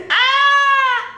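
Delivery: loud, very high held "ah"; piercing excited cry; high shriek. Real, not acted. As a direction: shriek in delighted shock. Exclaiming while recalling a scene.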